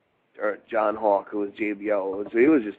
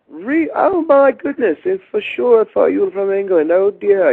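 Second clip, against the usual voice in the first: astonished Englishman; 11 semitones higher, 9 dB louder than usual. Retold or imitated. Adrian Street shocked the rookie isn't English, shrill British mock. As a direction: gasp it out like a shocked English dandy. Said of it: "He goes".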